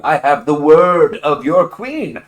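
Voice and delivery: high, loud, eager wheedling; butter up the queen's rival; nervous flattering messenger. Acted. Wallaby sweet-talking the crustacean boss, high and eager.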